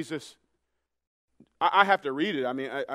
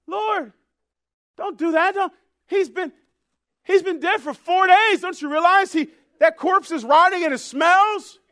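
high, loud protest; interjecting Martha; 13 semitones higher, 12 dB louder than usual. Acted. Martha interjecting to stop Jesus at the tomb, loud high protest. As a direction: object in a raised, insistent voice. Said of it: "Guess who interjects?".

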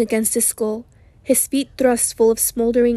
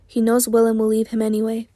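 usual speaking voice

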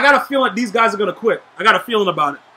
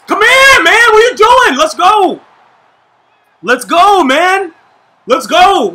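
hype shouting; 6 semitones higher, 6 dB louder, slower than usual. Real, not acted. Hyping up teammates mid-game.

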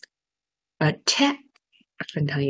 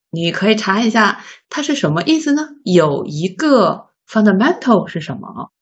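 usual speaking voice

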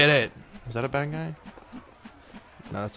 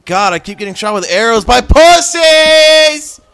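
much higher, much louder raging yell; furious shout; 10 semitones higher, 14 dB louder, slower than usual. Real, not acted. Gamer shot again by arrows, furious screaming rage. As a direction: scream it at the archers.

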